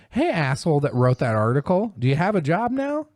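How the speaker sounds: mocking taunt. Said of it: "I'm like".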